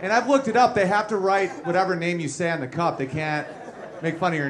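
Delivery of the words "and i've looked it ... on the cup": ordinary talk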